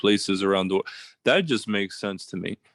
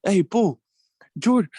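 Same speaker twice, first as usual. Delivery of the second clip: high, loud mimicked hail; imitated friend calling out; 11 semitones higher, 5 dB louder than usual. Host retelling how a friend hailed him, high mimicking call. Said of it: "He's like".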